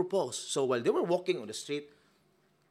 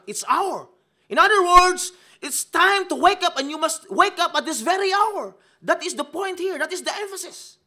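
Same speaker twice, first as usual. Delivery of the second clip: high, loud pulpit preaching; preaching at full force; 10 dB louder than usual. Acted. Preacher hammering the point to the congregation, loud and high. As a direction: lift the room with a loud, high sermon.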